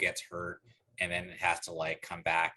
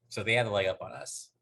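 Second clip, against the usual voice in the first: ordinary delivery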